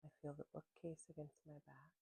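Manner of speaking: calm, unhurried voice with long pauses; gentle grounding-practice guide; guided meditation. Real, not acted. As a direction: ease listeners into their senses, letting phrases settle and fade.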